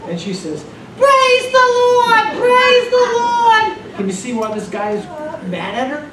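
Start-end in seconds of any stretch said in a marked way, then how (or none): 1.0-4.0 put-on very high, loud, slow screeching old lady; shrill sung-out old woman praising; screeched high-pitched praise. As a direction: screech it out like an elderly church lady. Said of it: "screeched, high-pitched voice".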